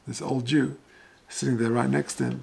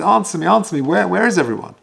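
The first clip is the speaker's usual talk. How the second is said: raised, higher-pitched insistent plea; agitated demand; 5 semitones higher, 8 dB louder, faster than usual. Retold or imitated. Agitated old man demanding the cantor answer him, loud and insistent.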